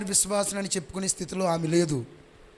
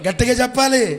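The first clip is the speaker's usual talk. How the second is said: loud, raised call to the crowd; urging louder; 8 semitones higher, 8 dB louder than usual. Real, not acted. Preacher prompting the congregation, firm raised call.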